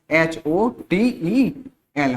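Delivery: एच ओ टी ई एल spelled slowly, one letter at a time. Shown as a demonstration. Teacher spelling एच ओ टी ई एल slowly for students. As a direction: spell out एच ओ टी ई एल for the class. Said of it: "English में उसकी spelling क्या होती है".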